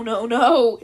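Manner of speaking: ordinary delivery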